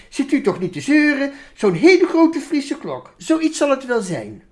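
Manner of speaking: irritated minister. Acted. Minister brushing off the sheep, mildly irritated.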